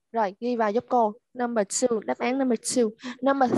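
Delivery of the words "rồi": normal talking voice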